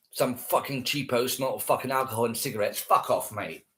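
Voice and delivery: ordinary talk, nothing marked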